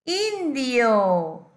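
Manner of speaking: INDIO loud, stretched with a falling tune; drawn-out demonstration. Shown as a demonstration. Teacher asking where the I is, INDIO loud and drawn out. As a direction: draw out INDIO loudly. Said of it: "escúchame bien para ver dónde está".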